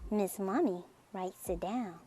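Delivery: ordinary delivery, nothing marked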